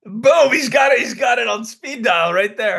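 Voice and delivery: gleeful teasing shout; excited laughing shout. Real, not acted. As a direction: crow it with a laugh. Teasing friend laughing at phone.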